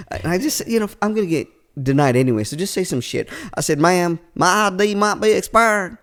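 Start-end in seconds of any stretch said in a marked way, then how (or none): 3.8-6.0 put-on Southern accent. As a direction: talk your way out in a Southern accent. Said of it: "The accent's pretty good".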